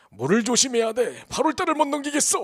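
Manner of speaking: a stranger monk foretelling disaster; ominous monk's warning. Retold or imitated. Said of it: "하고 가버렸어요".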